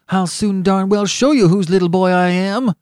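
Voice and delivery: loud, high ferocious retort. A narrator's reading. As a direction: fire back furiously in a treble. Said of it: "in a ferocious voice".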